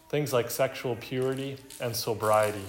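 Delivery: ordinary talk, nothing marked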